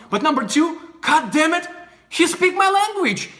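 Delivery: high-pitched, excited mock gushing; impressed reaction. Russian girl amazed he speaks her language, excited. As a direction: act out thrilled disbelief, voice climbing. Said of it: "person would be like".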